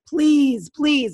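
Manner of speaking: loud high begging please; pleading friend. Retold or imitated. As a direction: beg like the eager friend. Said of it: "And she was like".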